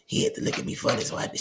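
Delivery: breathless whispered words; imitating the ex-wife out of breath on the phone; winded. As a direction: gasp it out like after a two-mile run. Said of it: "out of breath".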